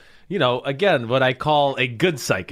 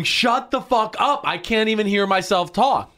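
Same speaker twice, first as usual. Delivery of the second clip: mock yell; 6 semitones higher than usual. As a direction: yell it up high like you can't hear yourself. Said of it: "I'm in the huddle going".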